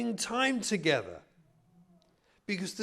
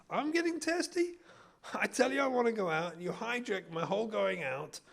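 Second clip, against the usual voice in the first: testy. Acted. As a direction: throw the accusation back, testy and affronted. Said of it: "why are you getting so testy".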